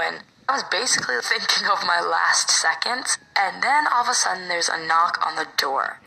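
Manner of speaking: normal talking voice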